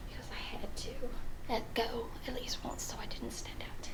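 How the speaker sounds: soft hushed whisper. Real, not acted. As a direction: whisper the secret part. Quiet confiding aside.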